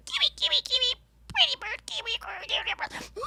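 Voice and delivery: very high, soft birdy chatter; parakeet voice. Retold or imitated. Retelling the parrot's kitchen chatter, high soft bird voice. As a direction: squeak the name like a parakeet talking to itself. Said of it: "he just was like".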